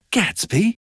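urgent. A narrator's reading. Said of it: "asked Daisy urgently".

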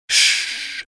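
whispered shh; hushing whisper. A narrator's reading. Miss Baker hushing Nick to hear inside, whispered shh. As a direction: hush them so she can listen.

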